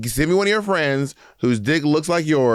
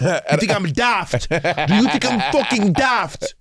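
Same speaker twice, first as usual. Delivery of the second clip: mock British chav accent, slow, building higher to the second "daft"; chavvy British accent; 8 semitones higher, much slower than usual. Mocking the British hookup's angry reply in a chavvy London accent. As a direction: drawl it out like an offended London lad. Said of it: "he's with his British accent".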